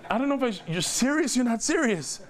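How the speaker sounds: high-pitched imitation of a doubting wife; incredulous wife. Wife reacting to his sudden praise, high and incredulous.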